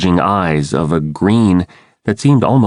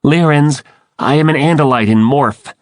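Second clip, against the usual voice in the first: high, slightly raised announcement; mild yell; 6 semitones higher than usual. A narrator's reading. The Andalite identifies himself to the Lyrans, mildly yelling. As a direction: call it out high, identifying yourself. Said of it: "I yelled".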